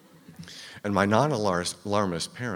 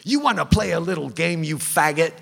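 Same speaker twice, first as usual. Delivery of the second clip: high, loud menacing taunt; robber's scream; 9 semitones higher, 9 dB louder than usual. Retold or imitated. Gunman screams at the clerk he holds by the hair. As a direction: yell it right at a pinned victim. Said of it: "he screams".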